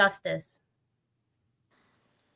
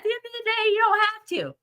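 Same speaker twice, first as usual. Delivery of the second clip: gentle bad news; faster than usual. Real, not acted. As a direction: let them down easy, very high and light. Gently letting him off the hook.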